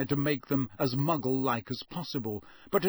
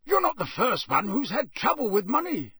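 high, loud country voice; a wary old Scots countryman; rustic campsite owner; 8 semitones higher, 3 dB louder than usual. Acted.

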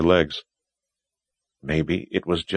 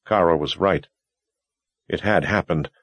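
usual speaking voice

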